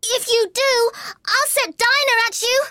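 put-on very high girl's shout; high-pitched, forceful warning; calling out loudly. A narrator's reading. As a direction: shout a threat as a little girl. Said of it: "called out as loud as she could".

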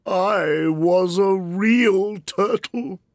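a mournful, sighing word, high creature voice; doleful turtle sigh; sighing Mock Turtle. A narrator's reading. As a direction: heave it out with a sigh. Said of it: "with a deep sigh".

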